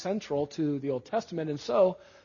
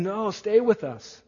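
pleading a little higher and louder, slowed down; eager urging; 5 semitones higher, 6 dB louder, slower than usual. Acted. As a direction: urge him warmly to stay, drawing it out. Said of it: "But they urged him".

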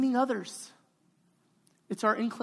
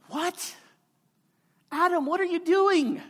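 higher, incredulous What?; mock astonished; 8 semitones higher than usual. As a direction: feign shock at Adam's excuse. Preacher voices God's astonished reply.

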